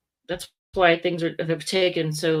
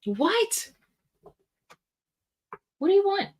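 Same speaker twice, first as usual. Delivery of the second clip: pet-talk voice; 14 semitones higher than usual. Real, not acted. Talking to her cat.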